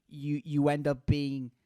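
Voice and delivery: ordinary talk, nothing marked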